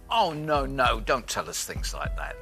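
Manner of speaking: ordinary talk, nothing marked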